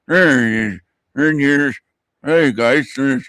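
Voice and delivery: mumbling with a clenched jaw; tight jaw. Retold or imitated. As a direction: speak without moving the jaw. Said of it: "I'm trying to talk without moving my jaw".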